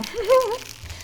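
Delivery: very high little giggle. Real, not acted. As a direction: giggle at your own joke. Laugh at baby everything.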